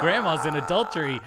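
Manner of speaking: put-on giggling through a kid's line; laughing quote. Retelling the kid's words while laughing. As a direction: laugh while quoting him.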